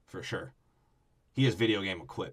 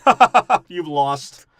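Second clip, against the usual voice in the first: loud, high fake laugh into a taunt; mock gloating laugh; 5 semitones higher, 10 dB louder, slower than usual. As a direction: laugh in their faces, hammed up, easing off after. Mimics gloating, laughing enemies.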